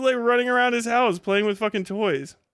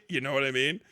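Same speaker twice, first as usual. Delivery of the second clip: put-on voice; 7 semitones lower, 6 dB quieter, faster than usual. Repeated in other voice.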